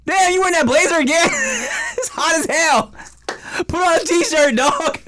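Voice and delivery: high-pitched incredulous roast with laughter breaking in; mock-exasperated teasing. Friend ribbing another over a hot-day blazer, mock exasperation. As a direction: rib him in a high, exasperated voice.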